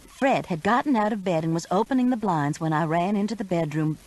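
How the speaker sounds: ordinary delivery, nothing marked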